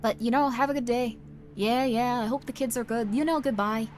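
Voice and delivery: lightly exaggerated Midwestern farewell; botched Midwestern accent, a bit Scottish; attempted Midwestern accent. Midwesterner dragging out a goodbye, loosely imitated Midwestern accent. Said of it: "That's not a Midwestern accent".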